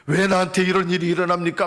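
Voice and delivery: insistent, resentful outcry; crying out. Acted. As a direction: cry out to God in complaint. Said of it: "막 부르짖는데요".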